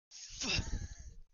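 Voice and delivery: squeaky high unvoiced laugh; high breathy laugh. Real, not acted. Laughing at his own Scottish joke, squeaky high laugh. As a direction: burst into a high wheezy laugh.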